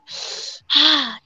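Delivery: big audible breath out; exaggerated calming sigh; demonstrative sigh. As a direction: show someone how to take a breath. Models taking a breath for customer.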